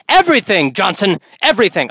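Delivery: high, punched, melodramatic cry; mock dramatic outburst. Leader lamenting to loyal sidekick Johnson, mock-anguished. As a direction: cry out in hammed-up despair to your sidekick.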